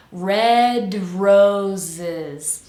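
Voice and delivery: "Red roses" slow, D released straight into R; linked D to R. Shown as a demonstration. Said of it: "we release and then jump right into er".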